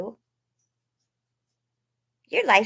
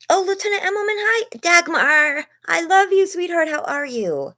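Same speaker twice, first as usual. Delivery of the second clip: much higher, louder gushing hello; excited greeting; 13 semitones higher, 5 dB louder than usual. Real, not acted. Streamer gushing over a viewer joining the chat. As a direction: greet a favourite viewer with delight.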